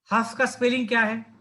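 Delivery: usual speaking voice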